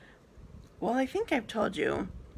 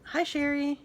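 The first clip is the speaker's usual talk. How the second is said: normal talking voice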